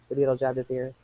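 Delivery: plain reading voice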